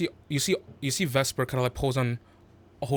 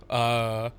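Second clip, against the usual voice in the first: ordinary talk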